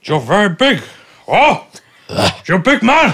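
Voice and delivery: high, loud mock awe at a big man; hammed-up loud greeting. Host hamming it up at the guest, loud and high. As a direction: belt it out like a cheesy host.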